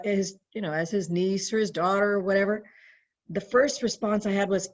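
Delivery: normal talking voice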